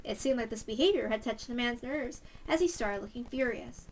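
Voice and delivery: ordinary delivery, nothing marked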